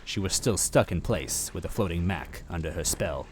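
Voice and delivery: usual speaking voice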